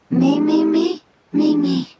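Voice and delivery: high squeaky creature calls; creature chirps. Acted. Fungus creature chirps its thanks to the travelers. Using this strange voice.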